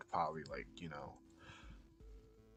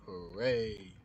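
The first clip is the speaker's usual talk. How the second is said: a bright little cheer; playful hooray; mock cheer; 9 dB louder than usual. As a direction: cheer it like a kid. Mock cheer while modelling.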